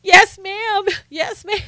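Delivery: high, mock-terrified squeak; frightened little boy. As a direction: imitate a scared kid obeying mom. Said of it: "And he goes".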